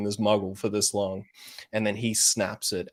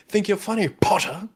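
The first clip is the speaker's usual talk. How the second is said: higher sneering Malfoy voice, "Potter" with a hard popped P; Malfoy impression; 9 semitones higher, 4 dB louder than usual. Quoting Malfoy's taunt to Harry, popping the P in "Potter". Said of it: "the P on Potter".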